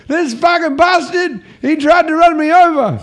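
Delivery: put-on high, loud, furious drunk rant; drunk bloke impression. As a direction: do the drunk bloke screaming blame. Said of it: "That's a good impression".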